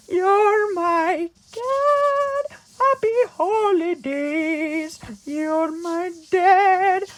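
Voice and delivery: very high, drawn-out loud squeal; hammed-up slow falsetto cry; mock overjoyed. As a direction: stretch every word in shrieking elf delight. Buddy overjoyed at meeting dad.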